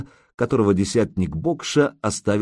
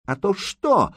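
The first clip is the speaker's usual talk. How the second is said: much higher, full-voiced challenge; defiant shout; 11 semitones higher than usual. Captive girl defiantly shouts back at Ilya. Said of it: "в полный голос крикнула девка".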